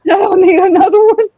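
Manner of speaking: mocking echo. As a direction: parrot his words back to make fun. Listener mocks the guy's line.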